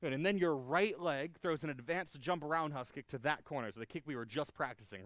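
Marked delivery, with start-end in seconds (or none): none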